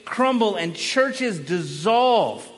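loud, rising preacher's build; fiery sermon crescendo; preaching at full force. Acted. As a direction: thunder it from the pulpit.

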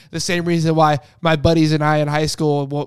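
normal talking voice

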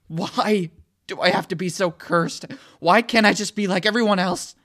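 loud, high anguished cry; furious despairing shout; raging. A narrator's reading. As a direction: howl it at the sky. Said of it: "I rage".

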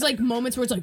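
ordinary talk, nothing marked

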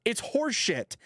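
high, slow, exasperated complaining; imitating a ballplayer fed up with the umpire; sarcastic high-pitched rant; mock angry player rant. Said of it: "is what he said".

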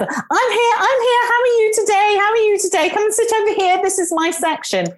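imitating an overjoyed waiter, very high-pitched; eager waiter. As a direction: gush like a waiter rushing over to favourite customers. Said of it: "he would come beetling over".

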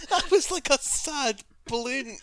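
high, slow laughing remark. Real, not acted. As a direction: laugh while saying it. Laughing at friend's noise.

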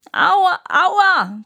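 high, loud trumpeting cry of pain; trumpeting elephant. Acted. Elephant with a bellyache bellows in pain, high and loud. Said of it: "trompetete der Elefant".

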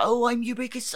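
put-on high-pitched Welsh accent. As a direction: Lee Evans doing Welsh. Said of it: "this is my Welsh accent".

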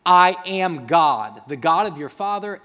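God declaring. Acted. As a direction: announce it with full authority. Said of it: "And he says".

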